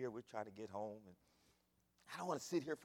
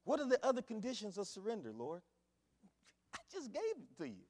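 usual speaking voice

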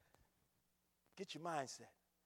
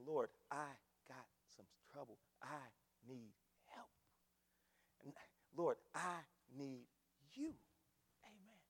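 low, hushed plea with long pauses; soft, halting appeal to God; humble prayer; 6 semitones lower, 7 dB quieter than usual. Acted. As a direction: pray it quietly, a few words at a time. Models a personal prayer.

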